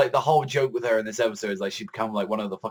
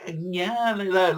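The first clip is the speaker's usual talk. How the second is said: mock schoolgirl; 9 semitones higher, much slower than usual. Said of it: "acting like a little schoolgirl".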